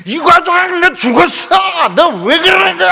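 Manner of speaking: high, furious-sounding shout at a boss; top-of-the-voice mock threat to a superior; venting yell. Shown as a demonstration. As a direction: demonstrate screaming out your frustration. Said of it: "소리 지른다 해서".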